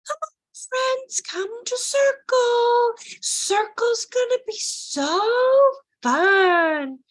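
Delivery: high, very slow, drawn-out sing-song; sugary teacher talk. Shown as a demonstration. Teacher mocking colleagues calling kids to circle, syrupy sing-song. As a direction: gush in exaggerated preschool-teacher motherese. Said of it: "We also tend to talk like that".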